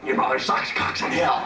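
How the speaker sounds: low mock demon voice; imitating the demon. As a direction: growl the line like the possessed girl. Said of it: "where Linda Blair says".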